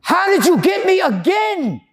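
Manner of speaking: high, loud, slow cry of disbelief; horrified shout. Retold or imitated. Man realizes he just relapsed in his car, shouting. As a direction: yell it at yourself in shock. Shock after drinking four bottles.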